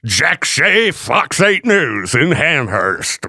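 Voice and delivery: slow, drawn-out reporter sign-off; news guy voice. Retold or imitated. Host mocking the reporter with a slow news-guy sign-off. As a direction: mimic a TV reporter signing off. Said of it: "News guy voice".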